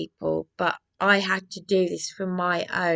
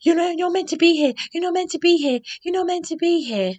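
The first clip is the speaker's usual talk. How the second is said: much higher, louder, fast caricature; shrill mock receptionist; 10 semitones higher, 5 dB louder, faster than usual. Nikki telling her she shouldn't be there, high mocking parody. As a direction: squeak it out as a frantic receptionist. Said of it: "this Nikki is going".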